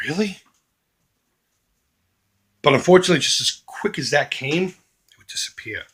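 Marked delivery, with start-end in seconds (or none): 0.1-0.4 higher-pitched, mildly excited question; light up briefly, imitating sudden delight; a touch of surprised excitement; imitated excitement. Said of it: "he'd get excited and he'd be like".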